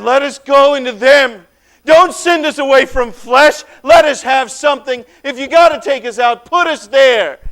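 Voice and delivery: loud, desperate bargaining; urgent pleading. Acted. Demons beg the stranger to send them into the pigs, urgent and loud. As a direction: demons haggling for a way out.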